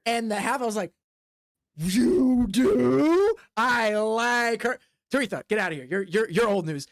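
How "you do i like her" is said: delighted. Acted. Half-elf delighted.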